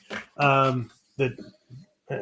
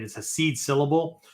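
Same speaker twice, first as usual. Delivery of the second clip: usual speaking voice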